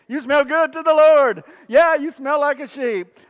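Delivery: high, loud, jokey exclamation; hammed-up neighborly compliment; playful mock greeting. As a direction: call it out high and teasing. Playfully acts out neighbors' exchange.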